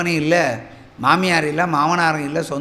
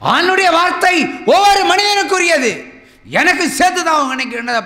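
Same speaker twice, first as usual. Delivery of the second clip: much higher, loud pulpit proclamation; full-force preaching; 13 semitones higher, 5 dB louder than usual. Acted. Preacher proclaiming God's word to the congregation, full force. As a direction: proclaim it over the whole hall.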